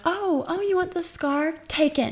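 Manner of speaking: high, exaggerated "oh, you want it?" sass; mock-defiant retort. Woman throwing the scarf at the mom, mock-defiant.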